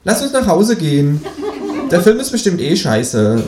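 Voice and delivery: high, cheeky kangaroo. A narrator's reading. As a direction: pitch way up for the kangaroo. Kangaroo character voice.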